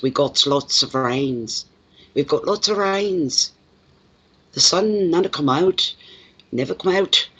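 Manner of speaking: put-on thick Glasgow accent. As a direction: drag it out like a Glasgow man. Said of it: "a lot of S's on the end".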